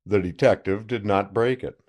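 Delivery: ordinary delivery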